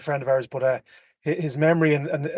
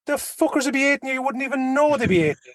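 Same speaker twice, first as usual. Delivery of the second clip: high, deadpan retelling; high mock-serious quote; 10 semitones higher than usual. Storyteller quoting the old roofer, high and deadpan. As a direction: deliver the line high and dead serious.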